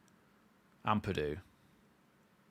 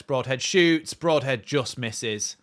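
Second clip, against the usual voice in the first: higher, louder shot call; excited; 5 semitones higher, 9 dB louder, much slower than usual. Real, not acted. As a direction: shout the shot, then the miss. Shot narrowly misses.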